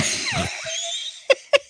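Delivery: shrieking burst of laughter. Real, not acted. Big laugh at church girl joke.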